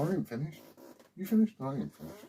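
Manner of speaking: usual speaking voice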